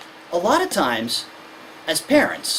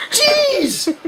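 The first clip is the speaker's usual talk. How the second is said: high, louder groan of Jeez; exasperated kid; 4 dB louder than usual. Retold or imitated. Lecturer as the kid exasperated with the parent, high pitched. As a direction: huff at the nagging parent.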